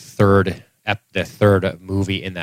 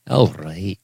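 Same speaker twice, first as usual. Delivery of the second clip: Scottish all right. Retold or imitated. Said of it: "like a Scotsman?".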